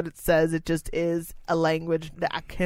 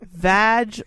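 ordinary talk, nothing marked

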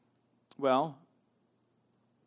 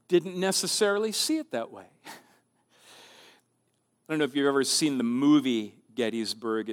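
ordinary talk, nothing marked

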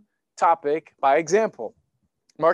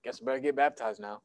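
casual, offhand Jesus; 7 semitones lower, 8 dB quieter, faster than usual. Pretend. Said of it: "then he's like".

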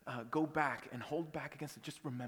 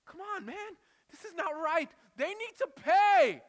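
screamed, much higher and louder, slowing at the end; angry outburst at God; 16 dB louder, slower than usual. Retold or imitated. Preacher reliving his furious prayer at the ship's stern. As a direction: rage at God as if it's happening now. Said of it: "I was angry with God".

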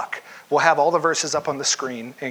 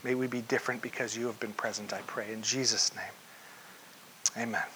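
soft, hushed prayer voice; closing prayer; 6 semitones lower, 13 dB quieter than usual. Acted. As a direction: pray quietly, reverent and low. Closing prayer before the sermon.

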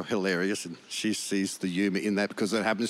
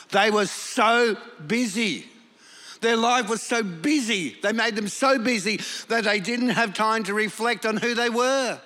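higher, fervent preaching voice; 9 semitones higher than usual. Acted. Pastor preaching about slavery in Egypt, raised and fervent. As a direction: drive home how busy they were.

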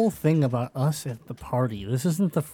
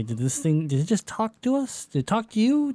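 climbing higher with each question, rising alarm; disbelief; 9 semitones higher than usual. Pretend. Ranger asks the party if the creature really talked, rising disbelief. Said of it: "I'm gonna look at them and say".

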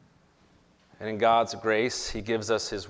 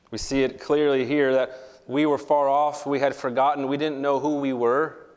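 preaching; 3 semitones higher, 3 dB louder than usual. Real, not acted. Preaching at rising force.

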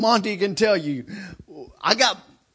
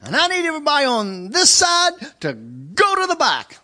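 raised, higher official call to the crowd; customs lady's announcement; 9 semitones higher, 5 dB louder than usual. Retold or imitated. Customs lady calling to the queue, loud and high. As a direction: project like an agent ordering a line. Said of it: "she says".

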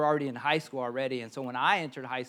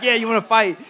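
cocky, louder, higher, picking a fight; tough kid challenge; 7 semitones higher, 11 dB louder than usual. Retold or imitated. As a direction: square up to the older guys. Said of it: "I'd be like".

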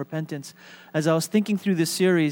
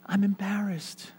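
soft, pitched-up bashful voice; shy child; 4 semitones higher, 7 dB quieter, slower than usual. Retold or imitated. Pastor imitates own kid being bashful, soft and high. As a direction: shrink back, hushed and small. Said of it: "My kid would say".

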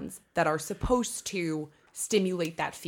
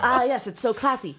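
mock Italian accent; 5 semitones higher, 3 dB louder than usual. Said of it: "So your Italian accent?".

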